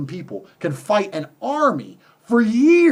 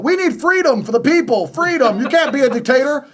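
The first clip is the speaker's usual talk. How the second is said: mocking quote; 5 semitones higher, 3 dB louder than usual. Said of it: "they start talking about".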